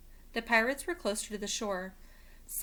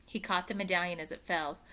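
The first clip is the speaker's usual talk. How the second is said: plain reading voice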